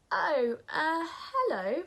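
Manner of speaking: high, startled stammer. Acted. Nora startled by the polar bear, high and stammering. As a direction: caught off guard, stumbling.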